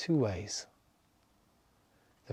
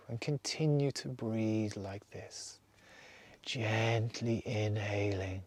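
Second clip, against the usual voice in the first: guided meditation; slower than usual. Acted. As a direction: lead them calmly from breath to breath. Leads a breathing meditation.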